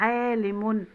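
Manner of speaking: عالم ending in -un; تنوين بالضم. Shown as a demonstration. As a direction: round off عالم with -un. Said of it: "وبالضم". (نطق عالم بضمتين في آخرها)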